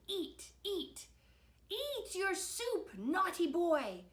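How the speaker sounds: high, slow, nagging order; insisting bad fairy. A narrator's reading. Bad Fairy forces soup on Victor, insisting. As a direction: draw out each command insistently.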